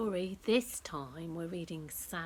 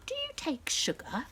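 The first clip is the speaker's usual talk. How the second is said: posh pretend hostess; 9 semitones higher than usual. Girls play grown-up tea party.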